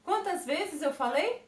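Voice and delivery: normal talking voice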